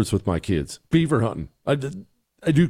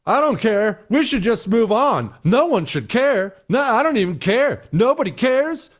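high, sneering shrug-off of critics; mock a careless official brushing it off; mocking dismissive quote; 10 semitones higher than usual. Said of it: "is a horrible thing to say".

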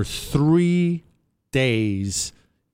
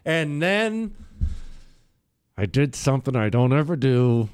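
ordinary talk, nothing marked

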